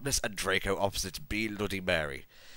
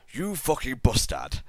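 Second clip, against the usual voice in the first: higher-pitched, mildly yelled insult; 5 semitones higher, 4 dB louder than usual. A narrator's reading. Draco insulting Vampire, mild angry yell. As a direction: yell it with some restraint. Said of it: "yelled Draco at Vampire".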